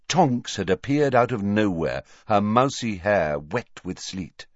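ordinary delivery

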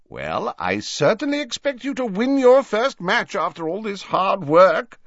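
loud, high, booming professor; theatrical old host, pitch held high; jovial, blustering Slughorn. Acted. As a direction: declare it grandly and heartily.

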